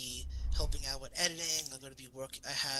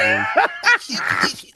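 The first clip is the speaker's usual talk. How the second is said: shouting through laughter, slow; laughing shout; 13 dB louder than usual. Real, not acted. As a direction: yell it while cracking up. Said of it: "laughing disbelief at Cobb".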